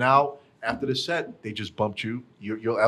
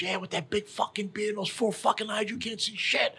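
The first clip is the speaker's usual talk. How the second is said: higher mock yell with a kid's rage; retold scream; 10 semitones higher than usual. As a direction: pitch it way up, yelling at him. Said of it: "he's fucking screaming at him".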